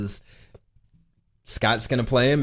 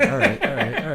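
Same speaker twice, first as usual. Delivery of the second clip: laughing; 3 semitones higher, faster than usual. Real, not acted. Laughing after the accent bit.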